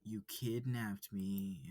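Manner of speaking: slow, deliberate words; slow flat accusation. Acted. Student accusing the wolf, slow and deliberate. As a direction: lay the fact down slowly.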